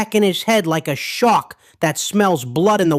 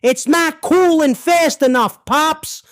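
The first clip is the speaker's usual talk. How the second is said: half-yelled, raised-pitch quote; retold scream; 5 semitones higher than usual. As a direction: imitate a panicked scream in mid-story. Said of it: "He's screaming".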